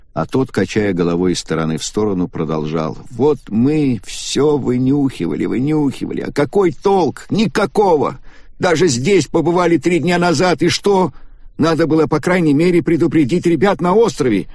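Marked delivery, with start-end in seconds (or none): 3.1-14.5 much higher, distraught lament; anguished young man. Acted. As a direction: wail over what was missed. Takaaki's anguished self-reproach.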